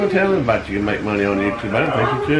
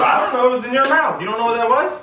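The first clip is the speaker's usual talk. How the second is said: high-pitched, agitated retelling; mock alarm; 10 semitones higher than usual. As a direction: act out a flustered back-and-forth, high. Retold alarmed exchange at dentist.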